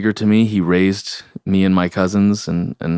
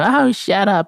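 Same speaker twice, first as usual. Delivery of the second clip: high, dismissive grandma voice, mock; grandma brushing him off; 4 dB louder, slower than usual. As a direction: toss it off like a curt, grumpy grandma. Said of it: "she'd be like".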